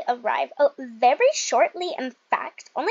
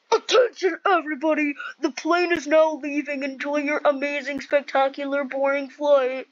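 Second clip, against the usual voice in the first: mock pilot announcement. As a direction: play the captain addressing passengers. Plays a plane announcer.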